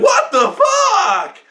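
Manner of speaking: high, drawn-out shocked yell; appalled shout. Retold or imitated. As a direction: re-enact a loud cry of disbelief. Reacting to second shooting, retold.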